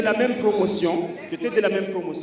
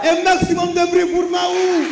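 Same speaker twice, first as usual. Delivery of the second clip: high, loud crowd hype; hype shout; 9 semitones higher, 8 dB louder than usual. Real, not acted. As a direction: pump up the crowd. Calling crowd to cheer.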